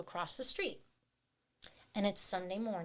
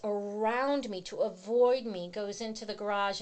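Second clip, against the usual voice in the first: ordinary talk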